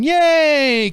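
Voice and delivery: high, drawn-out pretend cheer; mock celebration cheer. Passengers celebrating as the lights come on, high mock cheer. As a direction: cheer like a crowd at the finish.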